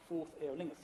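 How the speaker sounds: slow, loud, stirring battle-call recital; rousing poem recital. Acted. Lecturer reciting the riders' call to students, slow and loud. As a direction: declaim it like a king rallying riders.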